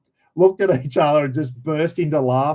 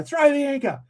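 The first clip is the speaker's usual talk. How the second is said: urgent cry well above usual pitch; mock urgent yell; 11 semitones higher than usual. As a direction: imitate a mate crying out a command. Mate's urgent order retold.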